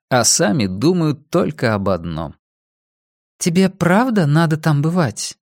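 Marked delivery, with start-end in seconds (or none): 3.4-5.4 high woman's voice, earnestly asking; serious question. A narrator's reading. Woman questioning Rex about his meetings, high and serious. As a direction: ask it in earnest, in the woman's higher voice. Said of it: "спросила она совершенно серьёзно".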